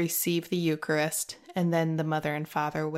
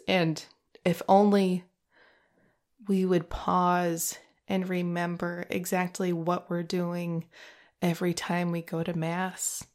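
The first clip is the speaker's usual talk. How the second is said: usual speaking voice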